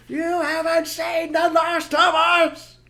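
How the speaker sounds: very loud, slow, high shout; slow loud high shouted vow; furious vow. Acted. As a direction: bellow the threat. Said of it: "vow shouted at fox".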